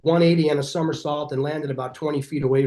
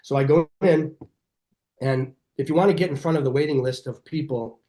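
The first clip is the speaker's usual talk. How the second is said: ordinary delivery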